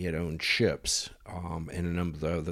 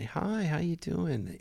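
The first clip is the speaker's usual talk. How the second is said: mock guest greeting; 9 semitones higher, slower than usual.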